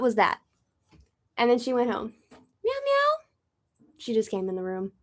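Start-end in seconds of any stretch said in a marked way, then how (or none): 2.3-3.9 very high meowing; mock meows. Real, not acted. Meowing at her cat, very high and light. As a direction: imitate a cat calling back.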